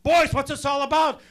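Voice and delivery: loud high furious dad yell; dad screaming. Retold or imitated. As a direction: yell at the boys like an angry father. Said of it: "He comes in the room screaming".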